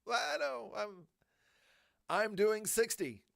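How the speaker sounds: high, pleading excuse; defensive whine. Retold or imitated. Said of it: "And I'm like".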